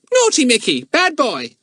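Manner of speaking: high squawky parrot mimicking a scolding. Parrot copying the boy's scolding, high parrot squawk. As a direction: screech it like a cheeky parrot. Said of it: "said Kiki at once".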